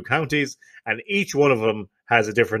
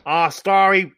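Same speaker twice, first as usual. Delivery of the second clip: higher-pitched, clumsy Dublin impression; bad Dublin accent; 7 semitones higher, much slower than usual. Showing co-host the Dublin sound, badly faked Dublin accent. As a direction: attempt a Dublin accent and miss. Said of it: "I'm absolutely terrible at accents".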